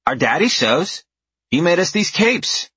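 put-on high, bright child voice; little girl voice. A narrator's reading. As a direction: voice a proud little kid. Narrator voicing a little girl.

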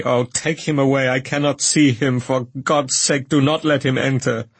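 high, pleading cry of terror; cry of agony. A narrator's reading. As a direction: beg them to keep him out, in anguish. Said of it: "cried out in agony".

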